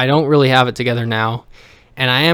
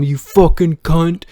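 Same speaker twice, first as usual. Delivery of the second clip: higher, slow mock-abusive outburst; imitated angry insult; 4 semitones higher than usual. Son imitating father abusing mom, slow mock-angry insult.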